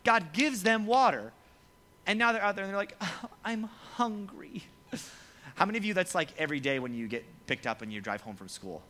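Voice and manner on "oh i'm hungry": slow, high, soft whine; drawn-out put-on complaint; mock whining. Mimics Israelites complaining.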